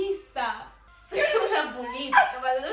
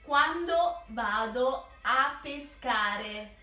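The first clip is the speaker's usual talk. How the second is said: slow, spelled-out words; slow, over-articulated; 4 semitones lower, much slower than usual. Shown as a demonstration. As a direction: mouth each word slowly. Repeating slowly for lip-reading. (scandito lentamente)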